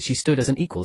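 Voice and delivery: plain reading voice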